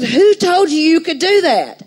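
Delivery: high, loud outraged question; retelling the angry dream question to the workmen, high and loud; indignant demand. Retold or imitated. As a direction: challenge them, pitch right up. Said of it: "I was so mad".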